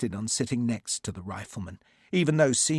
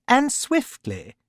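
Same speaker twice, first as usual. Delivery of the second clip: fussy lordship; 13 semitones higher, 7 dB louder, slower than usual. A narrator's reading. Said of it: "Lord Pumphrey supported Jackson".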